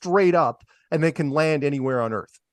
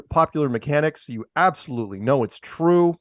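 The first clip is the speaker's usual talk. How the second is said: ordinary delivery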